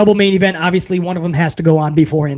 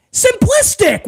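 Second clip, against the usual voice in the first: exasperated; slower than usual. Real, not acted. Exasperated rant about booking.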